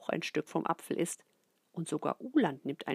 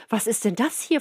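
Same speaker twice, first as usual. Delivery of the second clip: shocked question; 3 semitones higher, 8 dB louder than usual. A narrator's reading. Mother discovers crumbs, asks in loud shock. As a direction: gasp out the question. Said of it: "fragt sie".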